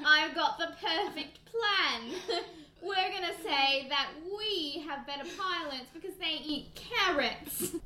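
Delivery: scheme grandly in a hammed-up British accent. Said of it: "love your British accent".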